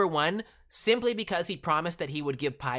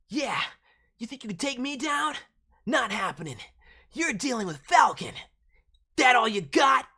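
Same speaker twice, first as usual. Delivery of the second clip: put-on higher, brash young ninja taunting; cocky teen voice; 7 semitones higher, 4 dB louder than usual. Shown as a demonstration. Streamer demoing Falcon's fight lines for a viewer, cocky and rising to a shout. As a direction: taunt like a confident teenage fighter, building to a shout. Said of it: "young teenager sort of voice, kinda confident".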